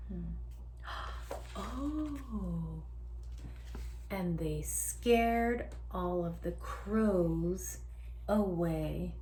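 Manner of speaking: usual speaking voice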